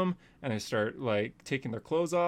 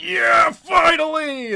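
much higher, louder, slow triumphant yell; gleeful shout; 10 dB louder, much slower than usual. Retold or imitated. Shooter celebrating at last, loud high yell. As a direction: roar it like finally winning. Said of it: "as he's shooting, he's like".

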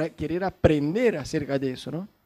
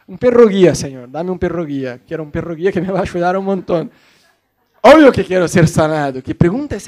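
exasperated; 7 dB louder than usual. Retold or imitated. Blind man answers Jesus, mock-exasperated.

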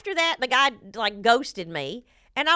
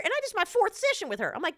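usual speaking voice

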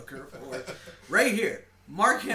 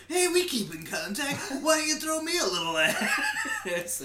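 sleazy come-on; 8 semitones higher than usual. Retold or imitated. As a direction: hit on her with a smirk. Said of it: "He's like".

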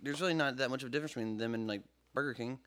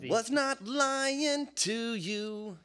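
slow, drawn out; 4 semitones higher, much slower than usual. Pretend. Drawn-out announcement of fact.

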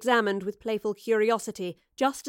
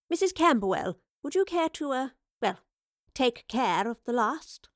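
professor voice; 4 semitones higher than usual. Acted. Professor politely asks, hesitating.

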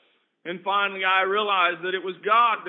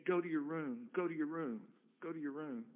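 softer on each go to your room, fading to almost nothing; fading inner prompting; 4 semitones lower, 12 dB quieter than usual. Retold or imitated. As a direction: let the repeated call die away into a hush. Repeating the Spirit's prompting, fading.

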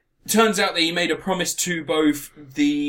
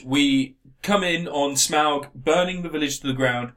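ordinary delivery

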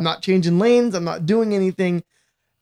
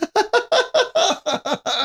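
high laugh; 13 semitones higher than usual. Real, not acted. Laughing at the steel drums joke.

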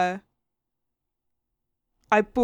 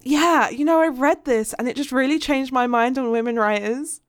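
higher, louder mock bookseller; imitated bookshop guy; 5 semitones higher, 4 dB louder than usual. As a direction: put on an earnest customer-pleasing shop guy. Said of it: "the guy in the bookshop was like".

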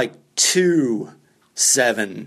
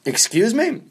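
higher-pitched, puzzled query; baffled; 8 semitones higher than usual. Retold or imitated. Comic answering the owner's garbled rant, acted bafflement. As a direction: ask it as if you caught none of that.

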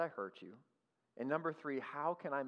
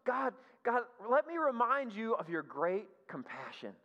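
fawning flattery; 7 semitones higher than usual. Retold or imitated. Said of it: "he keeps going".

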